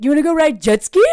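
loud, fast and eager; eager jet ski offer. Retold or imitated. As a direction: blurt it out like a happy boy. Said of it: "he's like".

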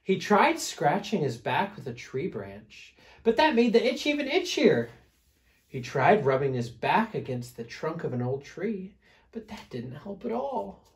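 lively, emphatic read-aloud, pitch swooping up; storybook narration. A narrator's reading. Reader telling children about failed scratching, animated storytelling. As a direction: build up the bear's troubles for young listeners.

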